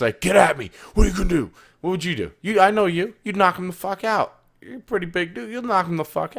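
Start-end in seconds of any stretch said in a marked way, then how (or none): 0.2-3.1 mock yell, higher and louder; hammed-up taunt, in his face; retold screaming. Tournament player taunting an opponent, mock yell. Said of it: "just screaming in your face".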